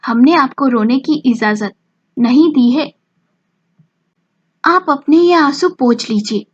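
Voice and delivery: a touch of stern mother-in-law, said firmly; mildly stern, forbidding her tears. A narrator's reading. Mother-in-law forbids her daughter-in-law's crying, mildly stern. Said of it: "कड़क आवाज में बोली".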